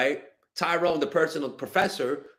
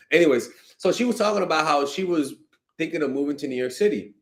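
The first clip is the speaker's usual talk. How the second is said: ordinary talk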